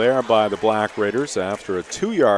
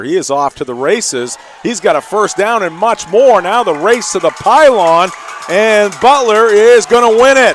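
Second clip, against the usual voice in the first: rising play-by-play shout on a long run; excited; 10 semitones higher, 10 dB louder than usual. Real, not acted. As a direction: build to a touchdown roar. Touchdown run call.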